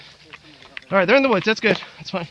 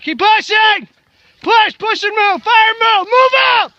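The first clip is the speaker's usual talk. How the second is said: shouted command; 6 semitones higher than usual. Real, not acted. Leader yelling team forward.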